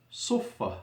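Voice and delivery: sufa with an emphatic Arabic s; Arabic sad. Shown as a demonstration. Teacher giving the Arabic root, sufa with emphatic sad. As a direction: say sufa with the heavy sad and doubled f. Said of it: "with a sad".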